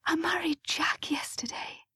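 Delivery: soft, high whisper; whispered confession. A narrator's reading. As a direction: whisper the secret to her in church. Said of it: "she whispered back".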